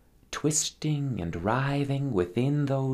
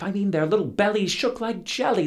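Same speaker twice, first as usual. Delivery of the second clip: ordinary delivery, nothing marked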